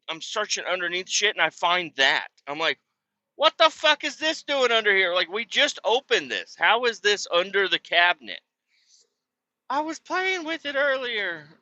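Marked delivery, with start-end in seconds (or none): 3.4-8.4 high-pitched, incredulous retold rant; exasperated disbelief. Retold exasperated outburst at finding sleeve. 9.7-11.5 high, put-on kid voice; high mimicked child's reply.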